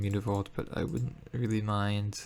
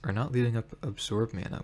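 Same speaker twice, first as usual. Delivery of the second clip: ordinary delivery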